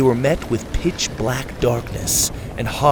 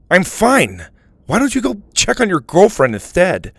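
louder, hurt and resentful; bitter; 6 semitones higher, 3 dB louder than usual. A narrator's reading. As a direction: push him away with spite. Hurt friend lashing out.

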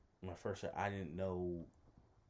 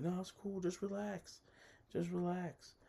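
high, even, reassuring woman's voice; high-voiced imitation, gently telling someone to relax; imitated soothing woman; 12 semitones higher than usual. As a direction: mimic her calming someone, pitched way up. Voices her calming his nerves.

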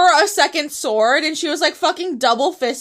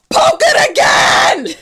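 excited shout; 11 semitones higher, 6 dB louder than usual. Retold or imitated. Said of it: "And Sam goes".

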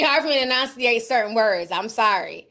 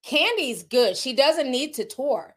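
normal talking voice

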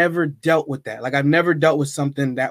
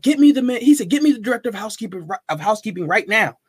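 higher, commanding call; bossy demand; 7 semitones higher than usual. Retold or imitated. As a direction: bark the order for the guest's benefit. Manager demands housekeeping director.

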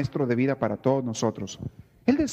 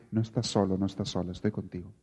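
softer and lower, tender comfort; gentle reassurance; 8 semitones lower, 7 dB quieter than usual. Retold or imitated. A friend comforts someone in trouble, soft and low. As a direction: say it like a friend holding you up.

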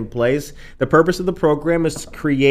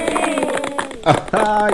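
high laugh; 7 semitones higher than usual. Real, not acted. Host laughs after cheer.

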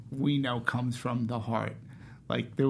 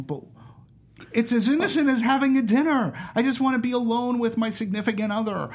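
insistent protest; 7 semitones higher than usual. Acted. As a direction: dig in and plead your innocence. Acts out digging in heels.

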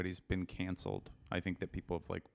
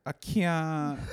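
laughing; 12 semitones higher, 14 dB louder than usual. Retold or imitated. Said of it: "and then I was like".

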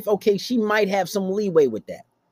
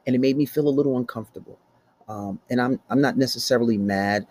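nice way; 5 semitones lower than usual. Shown as a demonstration. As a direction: explain it calmly and politely. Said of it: "you can do it in a nice way".

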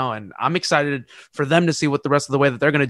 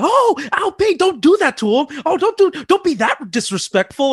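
hammed-up announcer, much higher, marveling; imitating commentator; 11 semitones higher than usual. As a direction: voice the commentator gushing over the dunk, pitched way up. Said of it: "and he was like".

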